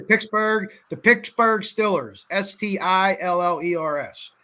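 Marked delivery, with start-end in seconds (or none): 0.0-0.7 Pittsburgh said as Picksburg; Pittsburgh pronunciation. Shown as a demonstration. A local showing viewers how Pittsburgh sounds as Picksburg. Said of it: "That's how everyone says it here". 0.9-2.2 Pittsburgh as Picksburg, Stillers with an i; slow local Picksburg Stillers; Pittsburgh pronunciation. Shown as a demonstration. A local showing viewers the team name said as Picksburg Stillers. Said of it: "That's how everyone says it here".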